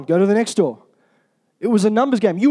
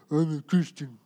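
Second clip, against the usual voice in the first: low, soft, droopy drone; mock-miserable, utterly joyless; 7 semitones lower, 8 dB quieter than usual. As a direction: say it with your jaw on the floor. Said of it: "need a forklift to pull their bottom jaw up".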